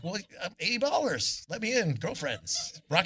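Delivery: high, slow, breezy swagger; cocky. Retold or imitated. Traveller breezily telling the grumpy officer to let him in. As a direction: play it like a rock star walking in.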